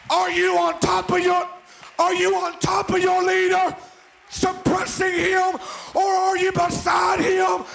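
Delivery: high, loud, slow preacher shouts; full-throated revival preaching; preaching at full force. Acted. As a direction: drive it home to the congregation.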